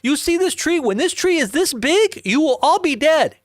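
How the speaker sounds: very high-pitched imitated rant; hammed-up high yell, sustained; retold screaming threat. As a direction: mimic a man screaming warnings, pitch way up. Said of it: "yelling at the top of his lungs".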